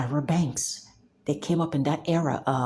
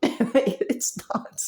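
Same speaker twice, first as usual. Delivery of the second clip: laughing; 11 semitones higher than usual. Real, not acted. Laughs while insisting.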